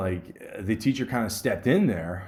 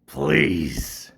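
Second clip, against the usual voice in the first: teacher calling order; 7 dB louder, much slower than usual. Retold or imitated. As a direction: snap then sink into a heavy please. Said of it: "turn around and just go".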